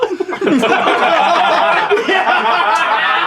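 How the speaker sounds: laugh. Real, not acted. Opening laugh.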